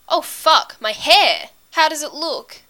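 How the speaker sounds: loud, high alarm; panicked realisation. A narrator's reading. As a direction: burst out in sudden alarm. Sudden panic about his hair.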